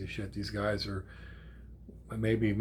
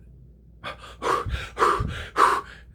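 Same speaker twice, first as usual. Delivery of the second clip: loud, hard puffs of breath; winded panting; 6 dB louder than usual. Retold or imitated. Grandpa after running uphill to granddaughter, winded panting. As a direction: act out heavy panting from running. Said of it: "I was just completely out of breath".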